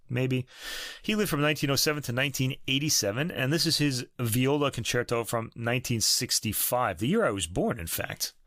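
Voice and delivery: normal talking voice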